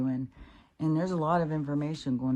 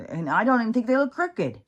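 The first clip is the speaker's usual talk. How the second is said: louder, higher exasperated rant; 9 semitones higher, 7 dB louder than usual. Real, not acted. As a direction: vent it out loud at the troll. Ranting at a rude commenter.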